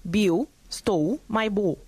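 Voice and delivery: slow Cockney "Bill stole my ball" with the L's turned to U; drawn-out Cockney try, "Biu stou mai bau"; self-conscious slow Cockney demo; Cockney accent attempt. Shown as a demonstration. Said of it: "Parecido a esto es mi intento".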